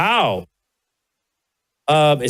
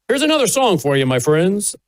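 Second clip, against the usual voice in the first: higher-pitched singer introducing the next song; 3 semitones higher than usual. Acted. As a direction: imitate a country star's stage patter, pitched up. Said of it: "That's how [name] used to talk".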